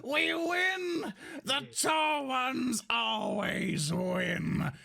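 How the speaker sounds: high, slow gloating villain shout; mad scientist. Acted. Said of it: "I am cackling madly at this point".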